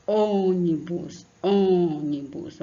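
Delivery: ônibus very slow and loud, leaning on the first ô; stress on ô. Shown as a demonstration. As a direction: draw out ônibus on the ô. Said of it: "A sílaba aqui, no caso, é só o O". (tônica no ô, bem devagar)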